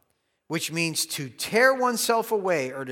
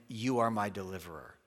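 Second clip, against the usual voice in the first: lower, softer, calm statement of trust; settled trust; 6 semitones lower, 7 dB quieter than usual. Shown as a demonstration. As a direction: say it at rest, fully assured. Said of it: "See the difference?".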